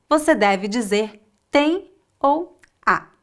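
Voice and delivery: ordinary delivery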